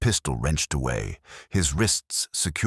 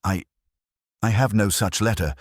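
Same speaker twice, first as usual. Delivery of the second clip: faltering; 4 semitones higher than usual. A narrator's reading. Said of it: "Elworthy faltered".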